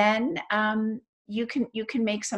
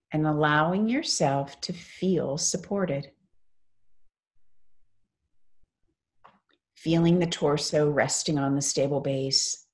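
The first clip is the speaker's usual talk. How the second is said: guided meditation. Real, not acted. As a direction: lead them gently into stillness, phrase by phrase.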